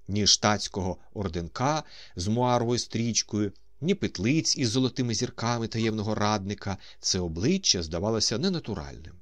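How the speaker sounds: ordinary delivery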